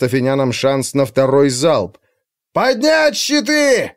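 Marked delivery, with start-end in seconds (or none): 2.5-3.9 high, slow shout, voice breaking; cracking shout. A narrator's reading. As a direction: yell it as the voice cracks. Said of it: "срывающимся голосом закричал Брасид".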